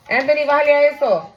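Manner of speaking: loud quick call across the room. Real, not acted. Mother calling out to her son Anthony, loud and quick. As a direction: hurry the words out at full voice. Telling child to turn down.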